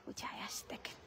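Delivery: hushed whisper to the camera. Real, not acted. Mother pointing out her son to viewers, whispered.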